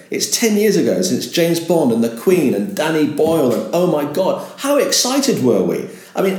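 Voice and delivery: high, laughing, gushing recall; excited presenter. Shown as a demonstration. Presenter on air recalling the Olympics, thrilled. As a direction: sell the memory like it's a party. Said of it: "you'll say".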